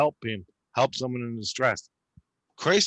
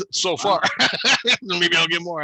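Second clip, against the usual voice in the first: laughing; 5 semitones higher, 7 dB louder than usual. Real, not acted. Laughing at his own story.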